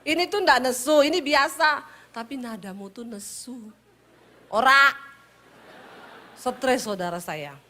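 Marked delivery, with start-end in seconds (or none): 4.5-4.9 loud retort. Retold or imitated. Wife's retort in argument.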